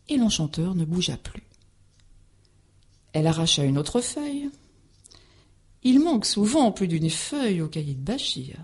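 ordinary delivery, nothing marked

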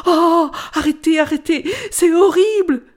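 high, loud shriek of disgust; potato crying out in horror; horrified cry. Acted. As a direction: beg her to stop, squealing in horror. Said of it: "s'écria la pomme de terre".

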